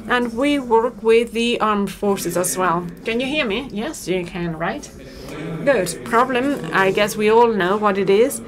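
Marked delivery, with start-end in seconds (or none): none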